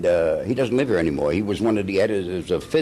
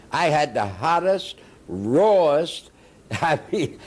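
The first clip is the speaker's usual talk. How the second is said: ordinary delivery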